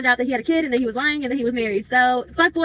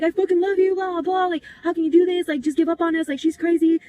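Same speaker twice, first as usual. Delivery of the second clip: retold screaming; 5 semitones higher than usual. Boyfriend pleading in front of his wife, mock screaming. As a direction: imitate his pleading yell up high. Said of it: "And he was screaming".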